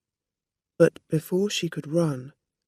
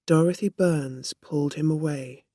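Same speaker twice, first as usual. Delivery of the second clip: plain reading voice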